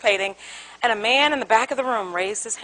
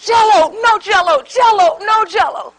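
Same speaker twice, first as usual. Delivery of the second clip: quarrel; 6 semitones higher, 6 dB louder, slower than usual. Retold or imitated. Spouses at each other's throats.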